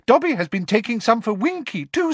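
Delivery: high squeaky house elf. Acted. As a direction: squeak it eagerly. Elf voice continues.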